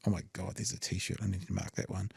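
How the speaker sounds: distracted, under-the-breath remark; quiet aside. Real, not acted. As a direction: drop away as if talking to yourself. Distracted aside about a T-shirt.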